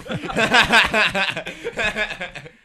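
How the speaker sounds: loud high burst of laughter; big laugh. Real, not acted. Teller laughing at the customer's reaction, loud laugh. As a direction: crack up out loud.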